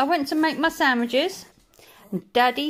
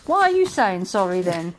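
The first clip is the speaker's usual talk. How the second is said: ordinary talk, nothing marked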